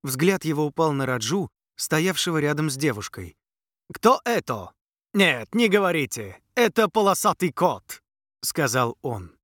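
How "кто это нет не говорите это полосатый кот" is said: pompous prince. A narrator's reading.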